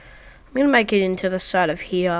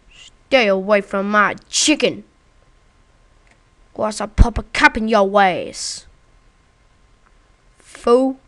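slow, louder hint of tough-guy threat; mock threat; 8 dB louder than usual. Warning the creeper off his chicken, louder mock threat. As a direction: warn them off like a thug.

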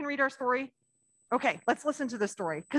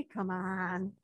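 normal talking voice

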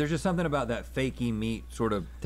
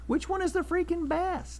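dramatic excitement; slower than usual. Acted. Dramatic hosting question.

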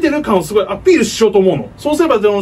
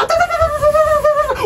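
very high rapid atatata cry; Kenshiro battle cry; faster than usual. Pretend. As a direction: fire off punches, screaming.

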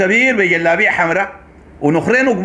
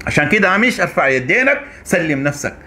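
ordinary talk, nothing marked

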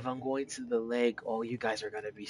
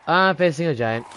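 higher, louder alarmed cry; excited; 5 semitones higher, 8 dB louder than usual. Real, not acted. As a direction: blurt it out alarmed. Alarmed at giant.

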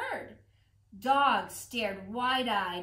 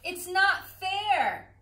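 whiny complaint; 7 semitones higher, 5 dB louder, slower than usual. Acted. Pigeon whines unfairness.

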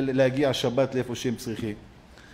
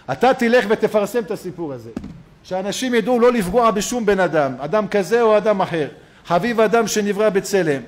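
raised, ringing sermon voice; louder preacher's call, higher in pitch; preaching; 5 semitones higher, 6 dB louder than usual. Acted. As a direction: drive the moral home to the congregation. Driver's demand, preached at full force.

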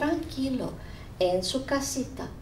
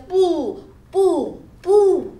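boo rising high and loud; spooky boo; 9 semitones higher, 8 dB louder, much slower than usual. Acted. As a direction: build to a big scary boo. Scary pumpkin head goes boo.

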